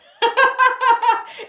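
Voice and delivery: loud, high real laugh; high-pitched cackling laugh; burst of laughter. Real, not acted. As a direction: crack up loudly at yourself. Cracks up at own jargon.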